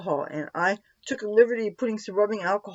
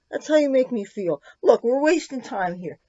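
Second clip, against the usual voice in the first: ordinary delivery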